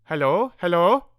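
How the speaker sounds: put-on loud high "Hello, hello?" in a corny German accent; thick German accent. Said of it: "the thick voice saying".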